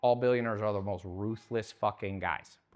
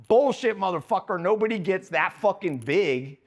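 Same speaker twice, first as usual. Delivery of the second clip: loud callout; 6 semitones higher, 4 dB louder than usual. Real, not acted. Comic to the crowd, loud and scornful. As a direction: shout it, louder and higher. Calling out fake modesty.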